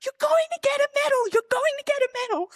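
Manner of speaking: high, fast mock yelling; squealed, rapid-fire hype, imitating a teammate; imitated excited cheering. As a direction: cheer like a coach jumping up and down. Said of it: "jumping up and down".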